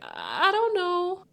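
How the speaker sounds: high, drawn-out unsure mimicry; imitating an uncomfortable, slow dodge; awkward hesitation. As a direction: stall awkwardly, voice sliding up high. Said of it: "like, awkward and".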